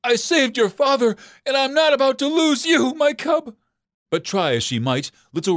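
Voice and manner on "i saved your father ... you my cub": high, pleading mother's voice; desperate mother fox. Acted. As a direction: plead with the dying cub, much higher. Mother begs her dying cub.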